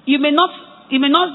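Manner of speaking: normal talking voice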